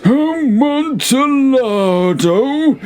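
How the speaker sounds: very high, long drawn-out cry; rapturous exclaim. Acted. Fortunato swooning over the wine, high and slow. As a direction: stretch the name out in ecstasy.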